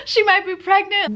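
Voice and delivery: frantic plea for help, voice cracking; a panicked owner calling a friend, laugh-crying. Retold or imitated. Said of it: "I'm like".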